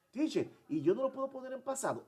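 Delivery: high, quick student voice; high-pitched eager classroom question. Acted. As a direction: pipe up like a puzzled pupil.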